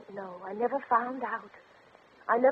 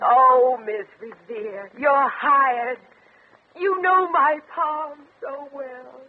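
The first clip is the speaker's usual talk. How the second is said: grateful outburst; 3 semitones higher, 7 dB louder, slower than usual. Acted. Spinster gratefully hiring the fortune teller.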